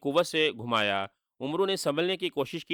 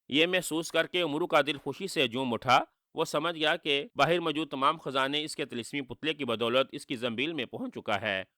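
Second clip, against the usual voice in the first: ordinary delivery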